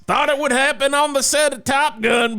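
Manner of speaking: high yelled punchline; shout. Acted. Host shouting the Top Gun virginity punchline in a high yell. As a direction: belt it out indignantly.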